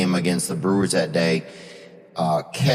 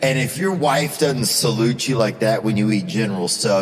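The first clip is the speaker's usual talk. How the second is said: normal talking voice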